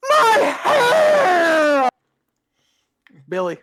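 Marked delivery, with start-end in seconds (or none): 0.1-1.9 high, loud drawn-out wail; Mandy. Acted. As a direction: shriek it in outrage, stretching the word. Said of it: "Mandy goes".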